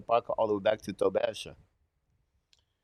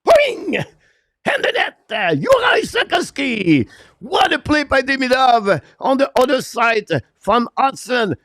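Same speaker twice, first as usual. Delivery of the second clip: high, loud screaming recap, stretching the name at the end; excited replay call; 13 semitones higher, 10 dB louder than usual. Real, not acted. Commentator replaying the goal play by play, still screaming. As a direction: keep yelling through the replay, drawing out the last name. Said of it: "I'm excited, too excited".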